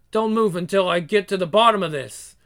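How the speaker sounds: ordinary talk, nothing marked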